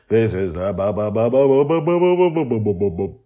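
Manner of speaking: repeated ba building in pitch; comic drumroll stammer; mock stutter. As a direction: stutter playfully before the big word. Stuttering buildup over music.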